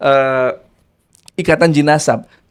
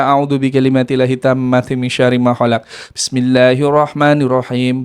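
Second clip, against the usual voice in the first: prayer recitation; 7 semitones lower than usual. Acted. Reciting protective prayer verses.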